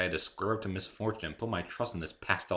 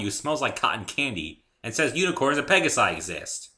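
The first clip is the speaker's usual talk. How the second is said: louder, higher frantic rant; louder frantic disbelief; excited rant; 6 semitones higher, 9 dB louder than usual. A narrator's reading. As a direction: rant at the sky. Narrator ranting.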